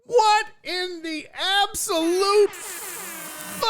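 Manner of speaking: high, drawn-out disbelief; stunned outburst. Real, not acted. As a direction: stretch each word high in shock. Shock at huge donation.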